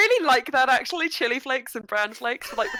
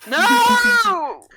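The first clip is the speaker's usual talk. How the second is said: high, loud shocked cry; loud protest; 11 semitones higher, 9 dB louder than usual. Real, not acted. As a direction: shout it in mock horror. Shocked outcry.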